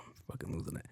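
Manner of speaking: breathy panicked whisper. Retold or imitated. Trapped comic whispering to himself in panic, unvoiced. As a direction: hiss it to yourself, losing it. Panicked aside, stuck in elevator.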